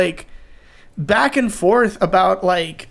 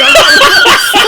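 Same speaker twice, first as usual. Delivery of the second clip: loud, high shouted noise breaking into laughter; sound-effect name; 14 semitones higher, 9 dB louder than usual. Pretend. Listing friends' names to a friend, laughing shouted sound effect. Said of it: "sounded like sound effects".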